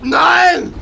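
high loud panicked scream; terrified scream. Acted. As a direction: scream in terror. Villain caught, screams.

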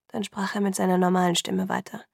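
usual speaking voice

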